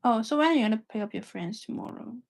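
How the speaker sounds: ordinary delivery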